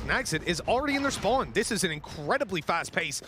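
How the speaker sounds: high, excited play-by-play call; esports caster. Acted. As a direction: hype the surprise pick.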